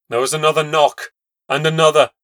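ordinary delivery

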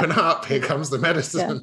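put-on high, loud sing-song coaxing a child to swallow; hammed-up parent feeding a toddler medicine; mock parent spoonfeeding. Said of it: "It was like".

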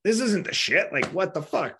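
exasperated, high-pitched mock outrage; retold fury. Patient fuming as his lips get sponged, furious retold protest. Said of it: "furious 'cause I'm like".

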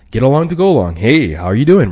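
bright, loud friendly greeting; upbeat small talk voice; get along to go along. Shown as a demonstration. Friendly small talk demo, loud and upbeat. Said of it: "the tonality where it's like".

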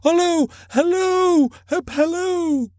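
very high-pitched halloo; high, drawn-out excited hailing; excited calling out. A narrator's reading. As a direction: whoop it as if calling after someone. Scrooge hallooing after Friday.

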